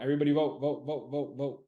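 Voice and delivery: loud chant of vote, vote, vote; rally the crowd to vote; loud insistent chant; urging everyone to vote. Real, not acted. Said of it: "calling out to viewers to vote".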